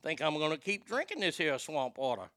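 lightly put-on Cajun accent; half-done Cajun voice; mock Cajun. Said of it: "why they turn Cajun all of a sudden".

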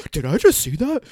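hammed-up trailer disbelief. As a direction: imitate a character stating the obvious. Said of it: "when [name] is like".

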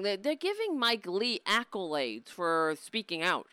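ordinary talk

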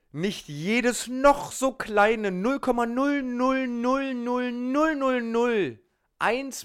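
very slow and high, stretching every null; drawn-out exaggeration. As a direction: drag it out to exaggerate. Exaggerating tiny beard hairs.

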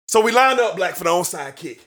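ordinary talk, nothing marked

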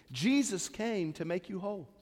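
ordinary delivery

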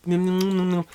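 small nibbling nom-noms; rat munching noises. Retold or imitated. Rat trying the cowboy's beans, imitated munching. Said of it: "and the rat's like".

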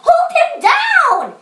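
high, drawn-out yelled order; urgent story-aunt holler; aunt's yell. A narrator's reading. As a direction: yell for help, pitched right up. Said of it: "yelled the aunt to Austin".

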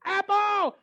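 very high, loud whoop; excited cheer. Retold or imitated. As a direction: cheer wildly over one apple. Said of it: "You get all excited about one apple".

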